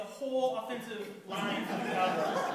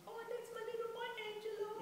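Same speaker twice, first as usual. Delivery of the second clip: high, soft little mother voice; high-pitched, gentle motherly lilt; doting mother; 13 semitones higher, 9 dB quieter, faster than usual. Acted. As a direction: coo it softly, way up high. Acting out Angelo's doting mother.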